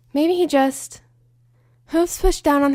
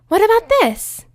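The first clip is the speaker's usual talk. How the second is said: very high, loud eager squeal; high eager pony squeal; excited Pinkie; 11 semitones higher, 6 dB louder than usual. Acted. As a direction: burst in bursting with eagerness.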